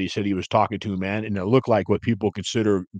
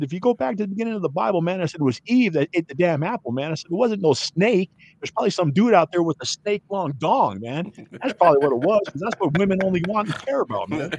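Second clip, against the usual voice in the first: heated rant; 7 semitones higher than usual. Retold or imitated. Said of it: "I had to go off".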